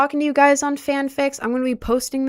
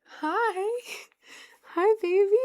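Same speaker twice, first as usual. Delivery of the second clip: higher cooing greeting to a pet; 8 semitones higher, slower than usual. Real, not acted. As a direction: coo at the cat. Greeting the cat.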